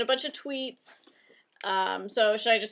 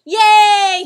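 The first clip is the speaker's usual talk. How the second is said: loud happy cheer; excited cheer; 9 semitones higher, 15 dB louder than usual. Real, not acted. Cheers toddler's greeting.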